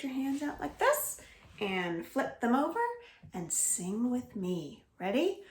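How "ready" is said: ordinary delivery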